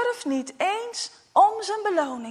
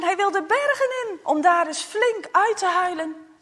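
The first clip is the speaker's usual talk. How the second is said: usual speaking voice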